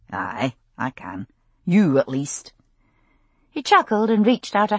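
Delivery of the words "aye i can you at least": low, slow Scots tease; lazy, lower Scottish drawl; Scottish man voice. A narrator's reading. Jamie voiced by narrator.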